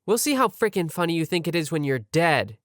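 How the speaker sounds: ordinary delivery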